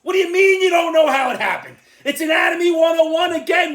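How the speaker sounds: loud, high exasperated rant; exasperated shout. Real, not acted. Shouting at a magazine cover, exasperated. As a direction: yell it like it's obvious.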